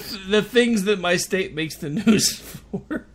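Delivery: slow, laughing exasperation. Real, not acted. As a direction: laugh it out in disbelief. Laughing at state's news.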